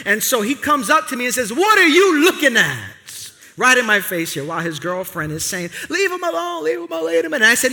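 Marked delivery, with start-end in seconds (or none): 1.5-3.0 higher, louder mock challenge; a hammed-up street challenge; confrontational stranger. Angry stranger gets in his face, mock loud challenge. 5.9-7.4 high-pitched mock pleading; lightly voiced girlfriend begging. Girlfriend holds the guy back, high mock pleading.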